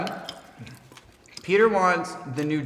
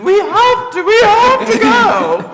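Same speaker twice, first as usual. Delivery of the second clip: loud high slow screaming; 11 dB louder, slower than usual. Retold or imitated. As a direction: yell it out in alarm. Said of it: "Fabian just screamed".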